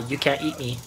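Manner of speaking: ordinary delivery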